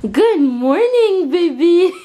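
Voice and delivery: high, loud, drawn-out cooing greeting; gushing pet talk. Real, not acted. Owner greets her dog in the morning, gushing baby talk. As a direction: coo a slow high-pitched good morning.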